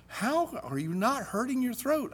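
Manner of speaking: a hint of a gushing teacher; music teacher voice. Teacher greeting new student, lightly voiced high and soft.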